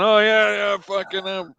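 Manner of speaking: big cocky put-on reply; cocky retort. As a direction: act out a lad showing off loudly. Acting the lad's cocky reply.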